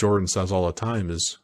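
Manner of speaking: ordinary delivery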